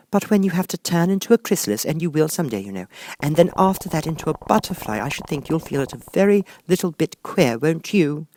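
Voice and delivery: put-on high young girl voice. A narrator's reading. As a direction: reason it out, high and light. Alice voiced by narrator.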